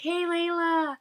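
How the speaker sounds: high, loud, drawn-out greeting; excited calling out. Retold or imitated. Friends spotting Layla while waiting, calling out excitedly. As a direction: imitate a group hailing a friend.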